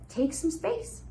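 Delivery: ordinary talk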